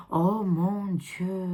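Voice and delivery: sharp gasp, then a slow, hushed oh my God; shocked gasp. Real, not acted. A reader gasping at a shocking card for her viewers, hushed and slow. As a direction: gasp as if the card just frightened you.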